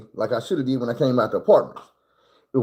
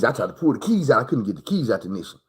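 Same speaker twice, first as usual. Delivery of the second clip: ordinary talk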